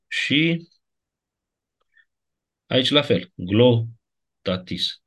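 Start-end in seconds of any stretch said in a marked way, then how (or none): 3.3-5.0 "Glotatis" spelled out very slowly; very slow classroom demo of "Glotatis". Shown as a demonstration. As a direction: drag "Glotatis" syllable by syllable. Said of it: "Din cauza encliticii".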